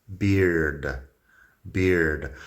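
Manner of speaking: teacher modelling beard; long i in beard. Shown as a demonstration. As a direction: lengthen the i of "Beard". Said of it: "tiene la I un poco más larga".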